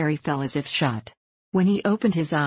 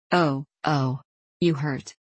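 faraway little voice; slower than usual. A narrator's reading. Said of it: "cried the same faraway little voice".

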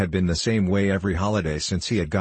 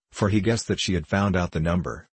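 ordinary delivery, nothing marked

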